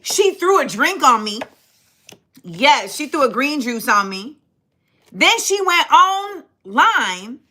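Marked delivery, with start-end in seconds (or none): none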